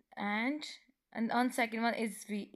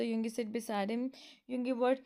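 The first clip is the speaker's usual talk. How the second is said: usual speaking voice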